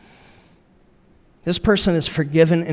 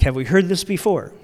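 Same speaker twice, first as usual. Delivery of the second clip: ordinary delivery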